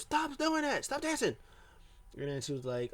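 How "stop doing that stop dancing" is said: very high-pitched mock shriek at normal volume; mock scream. Alexa ordering Asuka to stop dancing, mock scream. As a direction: imitate a screamed order in falsetto. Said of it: "And then she screams".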